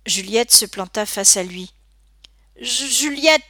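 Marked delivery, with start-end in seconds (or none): 2.6-3.4 higher, halting stutter on the name; stammering. A narrator's reading. Bully surprised by the girl facing him, stammering. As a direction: stumble on the first syllable, taken aback. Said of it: "bredouilla-t-il".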